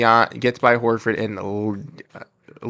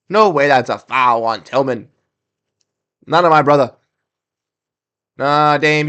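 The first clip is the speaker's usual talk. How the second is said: outraged; 3 semitones higher, 8 dB louder than usual. Real, not acted. Protesting the foul.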